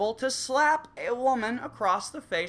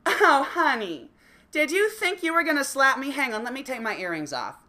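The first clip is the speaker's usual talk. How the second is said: mocking girlfriend; 7 semitones higher, 4 dB louder than usual. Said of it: "it's gonna be something a little like".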